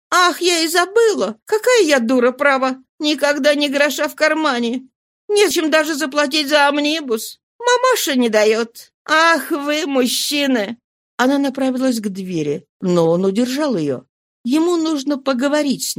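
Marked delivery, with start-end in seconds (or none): 0.1-10.8 high-pitched woman fussing at herself and at men; higher, exasperated character voice; Nana, exasperated. Acted. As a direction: play a vexed, scatterbrained woman lamenting.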